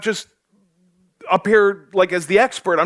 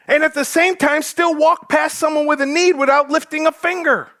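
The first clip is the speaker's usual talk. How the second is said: full-force preaching; 10 semitones higher than usual. Acted. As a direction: preach it hard to the congregation.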